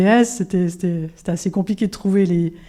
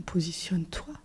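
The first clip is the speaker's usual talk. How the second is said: whispered command, pondering it; a hushed, thoughtful repeat of the order; soft whisper; 3 semitones lower, 12 dB quieter than usual. Retold or imitated. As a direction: whisper it back to yourself.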